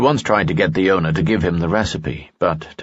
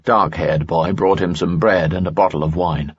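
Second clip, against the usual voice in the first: usual speaking voice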